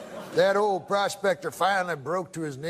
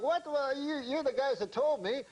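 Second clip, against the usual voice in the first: high, indignant protest; protest, wide-eyed and wronged; 5 semitones higher, 6 dB quieter than usual. Retold or imitated. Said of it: "Uncle Hector's, like, indignant".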